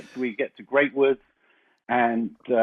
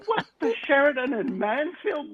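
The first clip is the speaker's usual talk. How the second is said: can't believe the mix-up; high, half-laughing disbelief; incredulous disbelief; 9 semitones higher than usual. Retold or imitated. Disbelief at wrong hotel.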